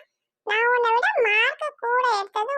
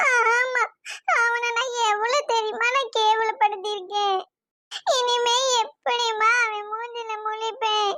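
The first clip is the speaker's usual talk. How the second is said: crying. Acted. Daughter dreads facing the boy she used to mock, crying. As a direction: bawl out the shame to mum. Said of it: "அதுக்கு அப்படி அழுதுட்டு உட்கார்ந்துருக்க?".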